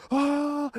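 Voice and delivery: high-pitched imitated "ah" of pain; mock pained scream. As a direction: cry out high as the stabbed man. Stabbed man cries out in retelling.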